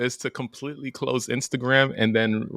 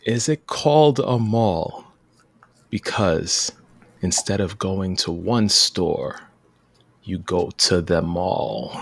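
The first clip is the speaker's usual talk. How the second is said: slow thoughtful delivery; 6 semitones lower, slower than usual. Acted. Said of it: "I hope I did him some justice how I delivered that".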